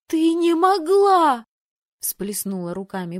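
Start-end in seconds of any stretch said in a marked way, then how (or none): 0.1-1.5 high, loud, very slow outcry; horrified sister wailing; shocked cry. Acted. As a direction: gasp it in disbelief.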